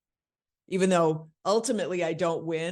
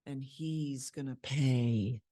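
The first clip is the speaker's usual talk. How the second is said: very slow, low-key threat; quiet menace; 3 semitones lower, 6 dB quieter, much slower than usual. Acted. Character secretly in charge scares him, slow soft threat. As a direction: draw it out softly to scare him. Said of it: "that scares him maybe".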